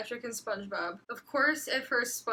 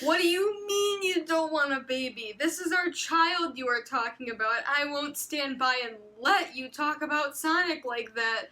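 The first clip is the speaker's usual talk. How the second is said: hammed-up tearful argument, higher and louder; melodramatic pleading; 4 semitones higher, 4 dB louder than usual. Reading a wife's fanfic plea, hammed-up and laughing through it. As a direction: overplay a wife begging her husband. Acted dramatic argument with husband.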